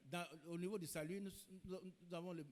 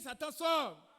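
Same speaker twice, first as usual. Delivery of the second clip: raised, forceful mock rebuke; acted rebuke; 3 semitones higher, 6 dB louder, much slower than usual. Preacher acting a believer waking to rebuke Satan, forceful command. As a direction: order the devil out, acting it.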